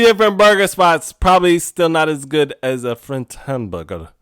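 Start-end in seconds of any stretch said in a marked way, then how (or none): 2.8-4.1 lightly attempted French accent; half-hearted go at a French accent; bad French accent. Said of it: "I can't do a French accent".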